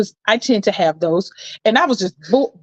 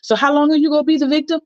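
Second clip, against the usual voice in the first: fast, higher, blunt question; quick high blunt jab; blunt challenge; 7 semitones higher, faster than usual. Retold or imitated. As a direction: fire it off matter-of-fact. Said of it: "and she says".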